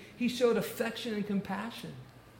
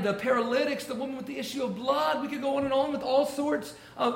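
louder, higher preaching; fervent preaching; 4 semitones higher, 5 dB louder than usual. Acted. Preacher rousing the congregation about Jesus healing, fervent. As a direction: build it up from the pulpit.

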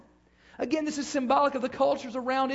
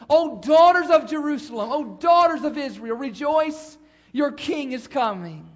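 proclaimed; 3 semitones higher, 8 dB louder than usual. Acted. Preacher proclaims prophecy to congregation.

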